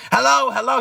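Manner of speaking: loud high repeated hello, shouting down the line; yelling on the phone. Retold or imitated. Friend yelling hello at the storyteller over the phone. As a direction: bark hello into the phone, fired up. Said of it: "He going off and off and off".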